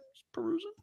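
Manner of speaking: whispered, innocent reply. Retold or imitated. Shopper whispering his excuse to the clerk. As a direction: breathe it out on a rising tune. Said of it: "they're like".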